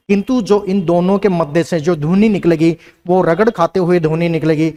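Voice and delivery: usual speaking voice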